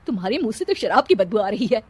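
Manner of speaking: ordinary talk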